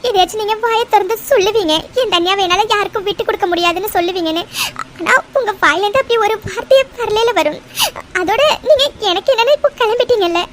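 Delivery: usual speaking voice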